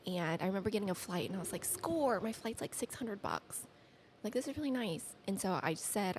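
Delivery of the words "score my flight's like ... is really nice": high, softly voiced glee; softer, high-pitched mock celebration; retold delight. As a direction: quietly replay the thrill of a bargain. Acts out finding cheap flight.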